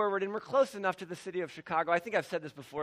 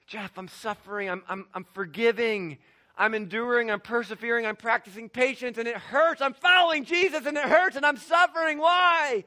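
hurting congregant; 5 semitones higher, 10 dB louder than usual. Retold or imitated. Hurting congregant pleading with pastor Jeff, rising to a cry. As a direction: voice a church member at the end of their rope. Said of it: "as people come to talk to me".

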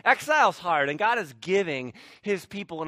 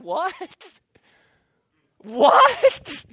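high loud astonished double what with a laugh; can't believe his ears; stunned disbelief; 11 semitones higher, 9 dB louder than usual. Retold or imitated. King hearing Daniel alive, laughing astonished what. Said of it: "And he's like".

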